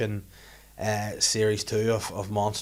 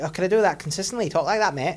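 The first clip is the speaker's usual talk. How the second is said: quick, higher matey voice; pitched-up, hurried character; silly comedy voice; 6 semitones higher than usual. Shown as a demonstration. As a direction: test out a comic mate voice. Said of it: "doing a wee silly voice".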